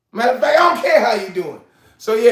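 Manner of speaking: ordinary talk, nothing marked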